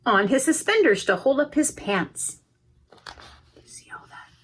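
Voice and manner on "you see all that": whispered aside. Real, not acted. Reader whispering to children about the picture. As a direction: lean in and whisper. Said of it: "whispered aside to children".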